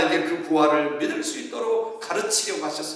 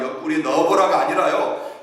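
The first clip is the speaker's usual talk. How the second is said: normal talking voice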